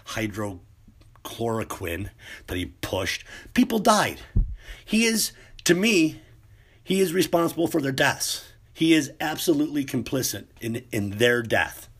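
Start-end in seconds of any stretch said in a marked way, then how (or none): 3.5-11.9 heated, higher and louder rant; real anger. Real, not acted. Vlogger venting about the president's fault, real anger. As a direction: let the outrage boil over. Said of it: "Everyone's got a fucking opinion about an attitude when you get angry".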